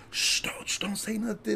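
high, softened shushing; urgent hushing. Retold or imitated. Laughing friend tries to silence him before stepmom, hushing. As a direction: imitate a friend shushing you quietly.

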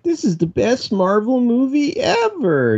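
high-pitched, over-the-top fan hype; mock gushing fanboy. Mocking fans who just left the movie, gushing fanboy voice. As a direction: imitate a dipshit fan gushing. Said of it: "one of those dipshits that's like".

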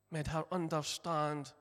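usual speaking voice

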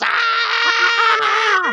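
put-on high shrieking baby scream, loud; a sudden, piercing, full-throated screech. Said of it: "it screams, and goes".

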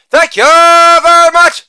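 hammed-up stage thank-you; showman sign-off. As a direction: stretch out the thanks like a showman taking a bow.

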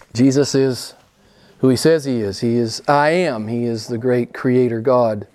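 ordinary delivery

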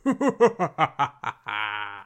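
creepy maniacal laugh; unhinged villain. Retold or imitated. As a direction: cackle like a villain who's lost it. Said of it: "just that straight up creepy laugh".

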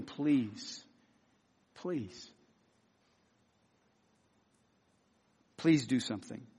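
hushed, low repeated plea; begging. Acted. Sufferer turning to Jesus for help, begging. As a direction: beg very softly, pausing between each please. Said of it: "and beg him".

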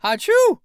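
very high loud sneeze; a big child's sneeze; little boy's loud sneeze. Acted. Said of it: "sneezed the little boy".

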